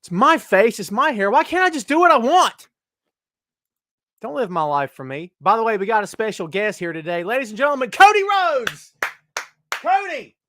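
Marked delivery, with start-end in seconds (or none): 0.0-5.3 mock indignant protest. Mock protest about his beard. 7.2-10.4 ring announcer. Pretend. Host hyping a pretend guest to the chat, booming ring announcer voice.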